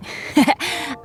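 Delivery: a small bashful chuckle; soft laugh. Real, not acted. Adventurer to traveler, a shy little chuckle. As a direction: laugh it off shyly. Laughs before describing himself.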